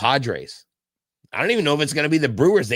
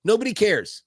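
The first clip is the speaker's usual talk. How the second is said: higher, punching "Nobody"; emphatic; 9 semitones higher than usual. Real, not acted. Host telling fans nobody cares, emphatic. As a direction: slam it home. Fired-up rant.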